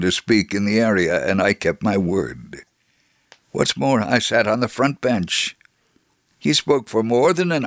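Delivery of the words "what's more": ordinary delivery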